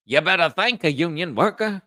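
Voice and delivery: high, loud caricature; put-on impression voice. Shown as a demonstration. Showing off voices to the chat, high, loud caricature. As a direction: belt it out high in a character voice. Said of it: "Which voice was your favorite?".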